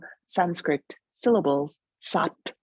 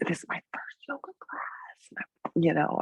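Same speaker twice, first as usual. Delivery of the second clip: hushed, whispery imitation of a nervous beginner; nervous newcomer; 6 dB quieter than usual. New student confessing to the yoga teacher, nervous whisper. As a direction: mimic a timid student confiding under the breath. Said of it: "they'd be really nervous".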